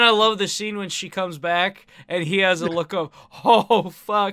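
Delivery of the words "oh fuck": loud startled oh fuck; mock dismay. His reaction when she comes back, a loud mock startled curse. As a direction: blurt it like you've been caught.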